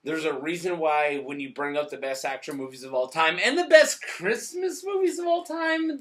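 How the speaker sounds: usual speaking voice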